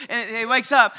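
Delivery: normal talking voice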